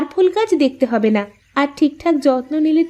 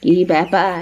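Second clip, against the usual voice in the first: put-on lower, older mother asking what's going on; mother-in-law asking what the two are discussing; curious mother-in-law; 6 semitones lower than usual. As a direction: drop in on the couple as his mother. Mother-in-law walks up to the couple.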